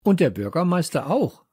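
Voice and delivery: high, eager chiming in; calling out. A narrator's reading. Leo adding to his friend's argument, calling out. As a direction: chime in pitched well up. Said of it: "rief Leo".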